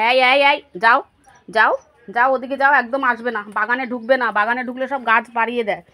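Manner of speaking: shooing away. Real, not acted. Shooing animals out of garden.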